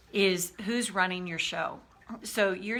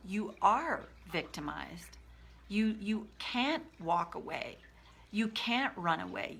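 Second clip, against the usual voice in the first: ordinary talk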